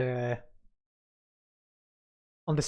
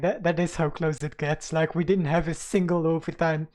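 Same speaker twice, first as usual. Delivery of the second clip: normal talking voice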